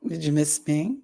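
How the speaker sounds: ordinary talk, nothing marked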